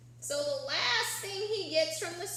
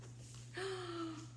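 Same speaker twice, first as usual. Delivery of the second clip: soft surprised gasp; 5 semitones higher, 10 dB quieter than usual. Acted. Turning page to surprise.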